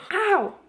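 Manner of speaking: high, sudden yelp of pain; sharp ow. Real, not acted. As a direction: yelp as the finger burns. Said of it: "I just burned my finger".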